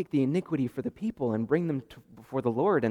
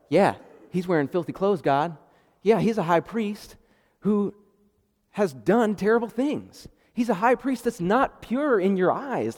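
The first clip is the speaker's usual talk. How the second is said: higher, louder sneering accuser; mocking accuser; 6 semitones higher, 4 dB louder than usual. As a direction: taunt God like a smug prosecutor. Satan accusing the high priest.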